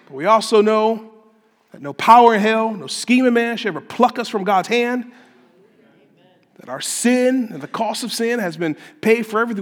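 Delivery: high, loud, emphatic sermon delivery; full-force preaching. Real, not acted. Preacher telling congregation sin cannot pluck them away, forceful.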